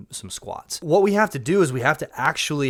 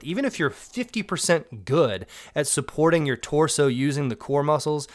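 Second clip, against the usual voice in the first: ordinary talk, nothing marked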